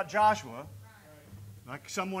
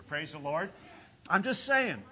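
ordinary delivery

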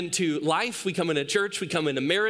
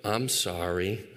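low, slow mock apology; imitated sheepish son; 10 semitones lower, 3 dB quieter, much slower than usual. Son standing next to the crying baby, imitated guilty apology. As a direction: mimic a guilty kid owning up slowly. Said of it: "Judah's standing right next to the baby going".

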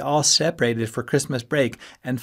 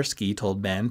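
plain reading voice

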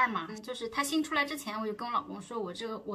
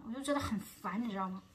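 ordinary talk

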